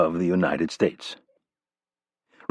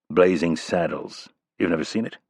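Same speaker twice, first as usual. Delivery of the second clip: plain reading voice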